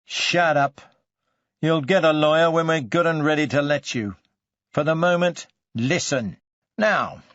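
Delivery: sharp, forceful interrogator shutting someone down; angry command. Acted. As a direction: cut the suspect off and take control. Detective cuts off lawyer request.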